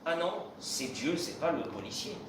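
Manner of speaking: normal talking voice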